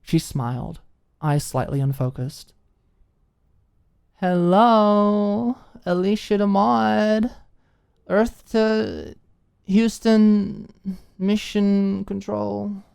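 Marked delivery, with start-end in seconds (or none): 4.2-12.8 high, loud, slow tipsy sing-song; drunk calling out. A narrator's reading. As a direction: call across the room, playfully drunk. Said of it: "lost in her allegory".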